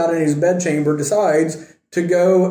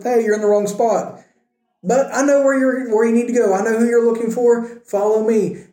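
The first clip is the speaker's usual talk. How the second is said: higher, bright offer to lead the way; sly helpful guide; 6 semitones higher than usual. A narrator's reading. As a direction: play Elisha luring the army with a friendly pitch. Elisha misleads the blinded army.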